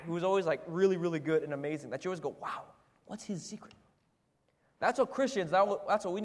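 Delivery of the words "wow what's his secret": very soft, marvelling aside; hushed awe. Retold or imitated. Onlooker amazed by a star coworker, hushed awe. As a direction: wonder almost under your breath.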